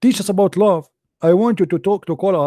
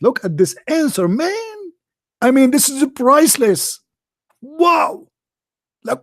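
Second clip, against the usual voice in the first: mock admiring fan; 10 semitones higher than usual. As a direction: squeal with fake amazement at a hero. Mocking Muslims praising the answer.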